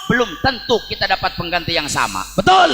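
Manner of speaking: high, loud call-and-response; fiery preaching. Acted. Preacher rousing the congregation with a loud, high roll call. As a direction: rouse the crowd with each title.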